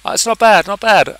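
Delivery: high, loud "not bad" of relief; pleasantly surprised. Real, not acted. Tutor checking the grid alignment, pleasantly surprised and loud. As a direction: sound delighted the grid almost lines up.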